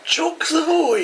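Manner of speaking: high-pitched, drawn-out mock British accent. Teasing co-host over the parade name, mock British accent. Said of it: "You sound so British".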